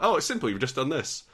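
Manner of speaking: high, loud quoted reassurance; imitating a chirpy, effortless explainer; breezy know-it-all. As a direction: toss it off as blindingly easy. Quotes fan explaining the fix breezily.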